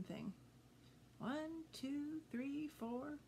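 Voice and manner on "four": ordinary delivery, nothing marked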